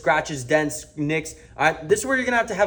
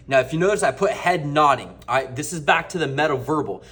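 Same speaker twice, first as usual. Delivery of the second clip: ordinary delivery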